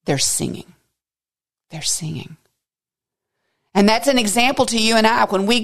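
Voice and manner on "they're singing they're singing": soft answer. Real, not acted. Answering own question softly.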